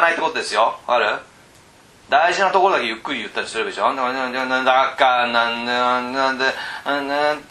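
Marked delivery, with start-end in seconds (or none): 4.0-7.4 droning monotone on one steady note; 淡々とした連呼. Shown as a demonstration. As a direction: drone it on and on with no point standing out. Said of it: "ってずーっと言ってると". (抑揚なく淡々と)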